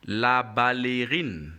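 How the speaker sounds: "la ballerine" slowly, ending in a long "reen"; slow, drawn-out "la ballerine", la-ba-luh-reen; French model pronunciation. Shown as a demonstration.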